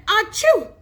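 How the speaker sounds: high, loud acted "achoo"; mimicked sneeze. A narrator's reading. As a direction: burst out with a fake sneeze. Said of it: "Then he'd sneeze again".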